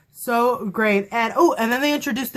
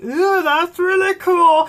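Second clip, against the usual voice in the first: impressed ooh; 8 semitones higher, 4 dB louder, slower than usual. Retold or imitated. Her impressed reaction.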